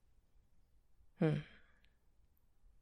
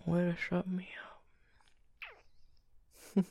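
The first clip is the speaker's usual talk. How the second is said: soft bedtime whisper; 4 semitones higher, 4 dB quieter than usual. Acted. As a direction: hush it like a partner in bed. Whispering in bed.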